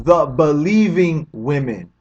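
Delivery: "believing" drawn out slowly and pressed; slow down and weight "believing"; slow emphatic stress. Real, not acted. Answering his own question to viewers, slow and emphatic.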